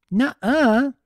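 high, loud bubbly objection; excited protest. Acted. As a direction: burst in with a cheerful no. Pinkie leaps in, protesting.

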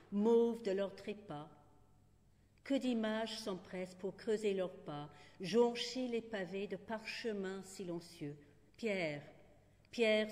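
measured recital with deliberate pauses; poetry reading. Acted. Poet reads the Prague cemetery poem to listeners, measured recital. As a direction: set each image apart, leaning on single words.

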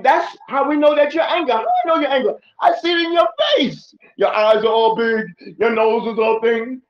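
high, loud, emphatic description of anger; loud animated preacher; animated loud preaching. Shown as a demonstration. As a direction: raise your voice and paint the angry face. Preacher acting out anger signs.

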